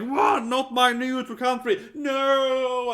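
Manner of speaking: high, loud, slow mock wail; mock scream. Imitating the classmate learning about Swedish arms, mock screaming wail. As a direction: ham up a shocked breakdown. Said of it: "started screaming and crying".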